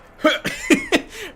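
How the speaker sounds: sudden high loud burst of laughter; high cackling laugh. Real, not acted.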